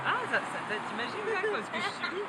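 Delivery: high squealing while tickled; being tickled. Real, not acted. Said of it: "Ça chatouille".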